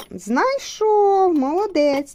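high, slow, drawn-out cheer; delighted praise. Real, not acted. Mother praising her son, delighted and drawn out. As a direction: stretch it out with delight. Praising child finding cube.

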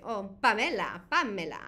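lightly imitated Caribbean accent on Pamela; viewer's accent. Streamer teasingly echoes a viewer's Caribbean accent. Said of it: "When you say Pamela with your accent".

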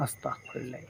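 whispered prayer, barely a breath; frightened whisper. Real, not acted. Praying under his breath in the dark jungle, whispered. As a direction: breathe the prayer out in fear, voiceless.

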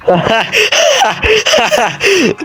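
high burst of real laughter; laughing. Real, not acted. Laughs at the Kurd player. (yüksek sesle gülme)

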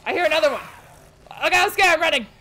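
ordinary talk, nothing marked

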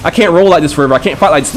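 normal talking voice

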